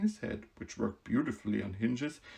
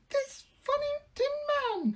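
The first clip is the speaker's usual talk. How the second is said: mouse queen voice. Acted. Queen telling the Woodman who she is, proud squeak. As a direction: announce her rank grandly in a squeak.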